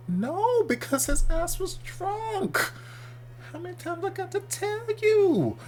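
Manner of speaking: high, drawn-out mock exasperation; exasperated retort. Reactor answering the confused characters, exasperated. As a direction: stretch it out high, theatrically fed up.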